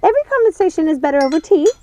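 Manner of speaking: high, bright fussing sister; Ruby's voice. Acted. Ruby voiced, fussing over tea.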